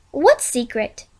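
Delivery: usual speaking voice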